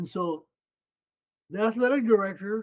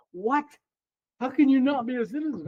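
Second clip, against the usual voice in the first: higher-pitched astonished questioning; imitated disbelief; 6 semitones higher than usual. Friend hears he isn't a citizen, retold astonishment. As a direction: act out a friend's startled incredulity.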